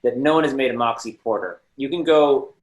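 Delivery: excited rant. Real, not acted. A panelist rants to the host about beer, loud and emphatic. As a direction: hammer home the shocking idea.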